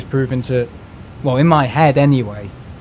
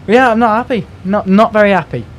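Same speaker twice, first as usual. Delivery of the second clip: high, loud exasperation; exasperated; 8 semitones higher, 6 dB louder than usual. Real, not acted. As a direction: let the frustration burst out. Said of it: "venting frustration".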